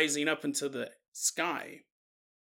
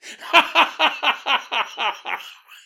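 a loud, high, real laugh; helpless laughter at the image; big laugh; 8 semitones higher, 4 dB louder than usual. Real, not acted. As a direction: burst out laughing. Host cracks up at story.

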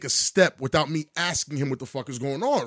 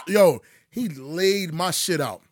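ordinary talk, nothing marked

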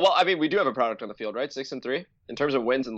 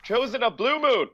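slow, high herald announcing; mock royal announcer; 10 semitones higher, slower than usual. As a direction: proclaim it like a court herald. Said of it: "So it'd be like".

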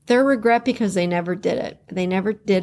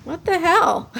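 laughing disbelief; 8 semitones higher than usual. Retold or imitated. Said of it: "and I'm like".

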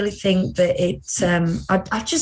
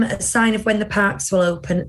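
ordinary delivery

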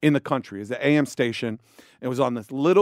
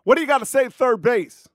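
higher, excited celebrity greeting; pitched-up friendly star voice; 5 semitones higher than usual. Retold or imitated. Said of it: "And [name] goes".

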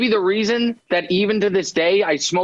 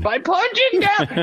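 mock echo; 13 semitones higher, slower than usual. Echoing host's joke back mockingly.

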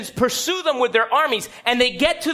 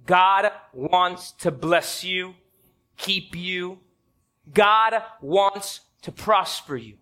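slow blessing; 5 semitones lower, much slower than usual. Acted. Preacher's slow blessing declaration.

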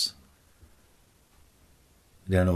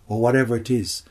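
usual speaking voice

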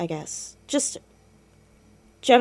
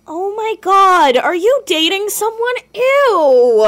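much higher and louder, a drawn-out gasp of delighted disgust; shocked teasing squeal; 11 semitones higher, 9 dB louder, slower than usual. Acted. Niece teasing her uncle about dating, gleeful shocked squeal. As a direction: squeal it like you just caught him out.

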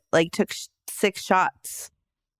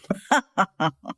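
evil laugh. Shown as a demonstration. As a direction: cackle like a cartoon arch nemesis. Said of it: "Mine, mine would be like".

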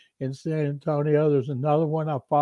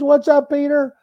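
much higher and louder, bright eager greeting; eager hello; 10 semitones higher, 9 dB louder than usual. Retold or imitated. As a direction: greet the big reporter with glee. Player eagerly taking the call.

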